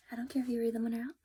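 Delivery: rushed breathy whisper, half joking; quick whispered aside. Real, not acted. Reviewer telling viewers she doesn't care, quick whispered aside. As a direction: toss it off under your breath.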